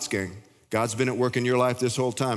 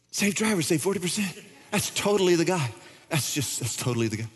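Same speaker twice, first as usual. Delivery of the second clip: higher, eager starstruck recognition; excited pointing crowd; 5 semitones higher than usual. Acted. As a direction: point and blurt it out like spotting a celebrity. Disciples voiced like the crowd.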